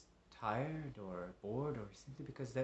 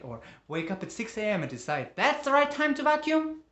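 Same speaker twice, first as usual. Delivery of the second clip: loud, exasperated, mounting outrage; angry rant; 5 semitones higher, 10 dB louder than usual. Acted. Man rails at noisy neighbors, angry rant. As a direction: rail at them with emphatic, raised pitch.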